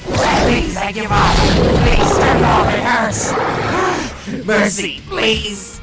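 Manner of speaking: high, loud pleas for mercy; desperate, pain-racked shouting; agonised begging. Acted. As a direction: scream and plead through the pain. Creature begs under sonic torture.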